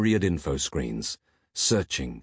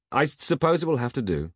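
mildly huffy, higher-pitched concession; quick huff; 10 semitones higher than usual. A narrator's reading. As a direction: give in with a little huff. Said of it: "huffed Peg quickly".

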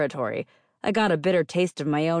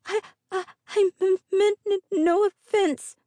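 frightened stammer; 4 dB louder than usual. A narrator's reading. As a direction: stumble over words in fear. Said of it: "Her stammers grew worse".

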